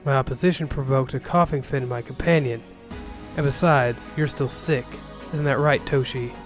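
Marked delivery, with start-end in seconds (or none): none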